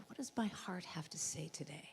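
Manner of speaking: quiet inward whisper; whispered question. Shown as a demonstration. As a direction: breathe the question to yourself. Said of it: "from that place of, like".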